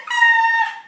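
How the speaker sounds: high, loud startled scream. Acted. Therapist sees Camilla melt into room, screaming. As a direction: shriek in fright. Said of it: "The therapist screamed".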